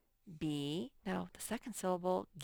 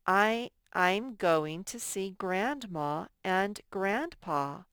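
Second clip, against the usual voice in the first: slow example sentence; 4 dB louder, slower than usual. Shown as a demonstration. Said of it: "example sentence read slowly".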